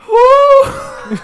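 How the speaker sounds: high loud whoop. Real, not acted. Storyteller whooping at his own story, loud high whoop.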